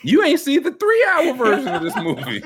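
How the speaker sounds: very high, loud, laughing squeal; incredulous teasing. Real, not acted. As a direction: tease him in a shrieky high pitch.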